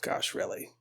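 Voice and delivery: barely audible, voiceless whisper; whispered echo. Acted. Visionary echoing the Pope's reaction, whispered disbelief. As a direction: echo it back in a tiny whisper.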